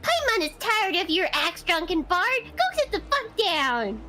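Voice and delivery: high squeaky scolding Paimon. Acted. Voice actress doing Paimon scolding the Drunken Bard, high and squeaky. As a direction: tell the bard off in a tiny shrill voice. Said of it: "She's like".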